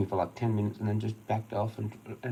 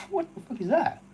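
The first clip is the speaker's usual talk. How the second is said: much higher, laughing squeal of disbelief; 5 dB louder than usual. Real, not acted. Mocking the coworker's dodge, high laughing disbelief.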